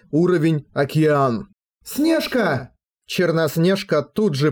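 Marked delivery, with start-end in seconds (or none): 1.8-2.7 calling out. Acted. Megumi calls out from water.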